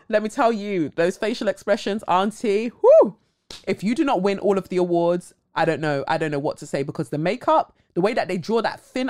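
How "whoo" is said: high loud whoop; excited whoo. Real, not acted. Cheering Auntie Viola's facial expressions, excited. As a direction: cheer her on.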